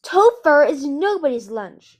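loud, high, slow indignant shout. A narrator's reading. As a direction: shout it fiercely, spacing the words. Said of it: "she shouted".

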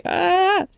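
short high mock squeal of fright; scared squeal. Scared going to the store. (chillido agudo de miedo)

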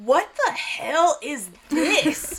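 slow, drawn-out bafflement with a laugh; amused disbelief. Retold or imitated. Child seeing divorced parents talk, mock disbelief. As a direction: stretch out each word, laughingly incredulous. Retold shock at parents talking.

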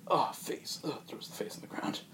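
soft, whispered disgust; grossed out, whispered under breath. Retold or imitated. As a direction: breathe out the revulsion. Disgusted at peeled face mask.

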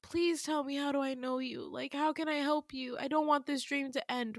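high, softening plea; beg, keeping the voice small; pleading. Retold or imitated. Dreamer begging him before the dream ends, pleading. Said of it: "begging him".

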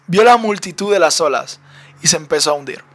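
ordinary delivery

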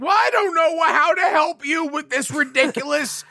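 overwhelmed dad losing it; exasperated parent. Retold or imitated. Dad overwhelmed by daughter's crying, high exasperated whine.

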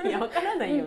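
laughing retort, no idea; giggling while protesting. Retold or imitated. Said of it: "って言われて".

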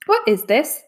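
a king demanding an explanation. A narrator's reading. King to approaching servants, demanding. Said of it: "the king demanded".

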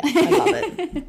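high laugh through the words. Real, not acted. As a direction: crack up while saying it. Laughing at own joke.

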